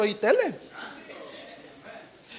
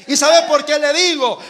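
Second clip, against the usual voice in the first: ordinary talk, nothing marked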